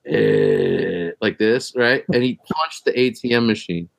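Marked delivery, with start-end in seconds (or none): 0.0-1.1 deep guttural grunt; low growl grunt. Retold or imitated. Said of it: "I'll never forget the sound".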